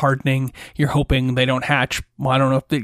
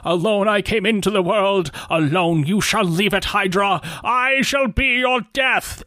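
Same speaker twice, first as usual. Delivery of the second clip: solemn paladin; 5 semitones higher than usual. Acted. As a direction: declare it like a doomed holy knight. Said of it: "He says".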